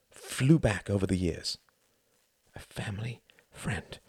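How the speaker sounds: ordinary delivery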